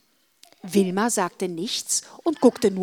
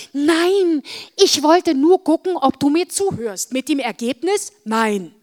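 scolding; 6 semitones higher, 7 dB louder than usual. Acted. Teasing sister scolds.

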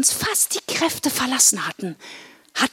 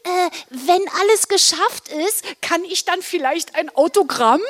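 high, dopey character voice; hopeful silly Blodi; 6 semitones higher than usual. Acted. Blodi asks Santa for an autograph, hopeful. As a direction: ask sheepishly in a raised pitch. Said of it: "fragte Blodi wieder".

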